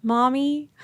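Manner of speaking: put-on small questioning kid voice; imitating young daughter. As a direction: ask like a concerned little girl. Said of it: "one of them says".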